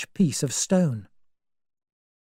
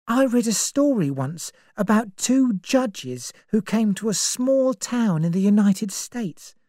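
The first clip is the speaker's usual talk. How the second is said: put-on higher, anxious young woman; young woman's voice; 7 semitones higher than usual. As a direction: voice Vera as uneasy and searching. Vera's lines in the story.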